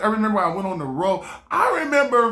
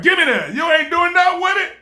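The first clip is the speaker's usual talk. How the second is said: God taking it back; 10 semitones higher, 6 dB louder than usual. Retold or imitated. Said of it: "You're like".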